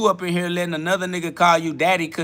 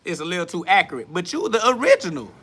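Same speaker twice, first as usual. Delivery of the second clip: high-pitched, squawking comeback; exasperated, high incredulous retort; indignant protest; 5 semitones higher than usual. Real, not acted. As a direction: defend yourself with pitch shooting up. Protests criticism of his accent attempt.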